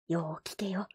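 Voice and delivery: put-on hushed old man whisper. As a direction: lean in and whisper the warning.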